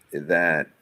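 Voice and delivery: ordinary delivery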